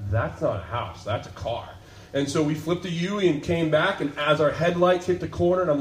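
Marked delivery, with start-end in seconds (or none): 0.1-1.7 hushed realization. Retold or imitated. Realizing in the dark at roadside.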